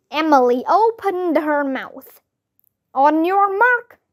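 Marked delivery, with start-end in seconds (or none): 2.9-4.0 mild narrated shout, raised pitch. A narrator's reading. Child starting the tooth pull, mild shout. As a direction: call out a race start, rising at the end. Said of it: "I shouted".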